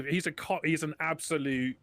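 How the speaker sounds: usual speaking voice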